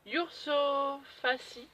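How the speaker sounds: « Yurso Fassi » articulé lentement, syllabe par syllabe; prononciation hésitante. Real, not acted. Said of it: "Je crois qu'on dit ça comme ça". (prononce lentement « Yurso Fassi » avec un accent français)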